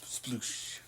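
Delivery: breathy soft whisper of Sploosh. Pretend. Friend adds a sound joke, whispered. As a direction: whisper it like a secret.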